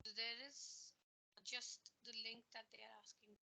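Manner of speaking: very faint, far from the mic; almost inaudible, faint murmur; barely audible. Real, not acted. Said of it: "Your voice is very low".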